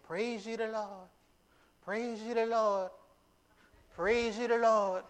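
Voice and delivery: high, slow, lifted call of praise; excited praise. Retold or imitated. Preacher showing David praising with excitement. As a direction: sing out the praise, rising with joy. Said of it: "he is saying it with excitement".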